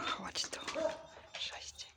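soft whisper to a dog. Real, not acted. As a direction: breathe it gently. Greeting dogs softly.

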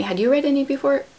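usual speaking voice

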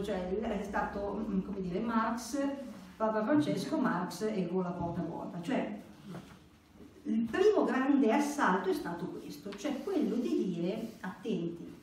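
normal talking voice

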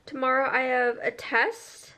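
usual speaking voice

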